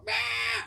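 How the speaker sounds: high mock screech; quick high "ah" as a monster; imitated creature screech.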